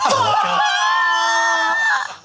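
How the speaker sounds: very high, long shriek of laughter. Real, not acted. Reacting to the crying manipulation claim, shrieking laugh. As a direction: burst out in a long squeal.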